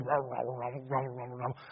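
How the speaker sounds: low, soft grumbling mutter; grumble grumbles. Retold or imitated. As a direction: grumble under the breath like the app's cartoon Pharisees. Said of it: "they'll go".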